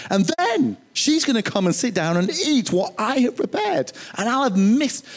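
indignación exagerada de Marta, voz más aguda y fuerte; irritated Martha. Retold or imitated. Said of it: "It's like".

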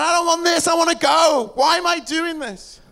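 high, shouted outcry; anguished protest. Retold or imitated. Preacher voicing Jesus wanting off the cross, anguished shout.

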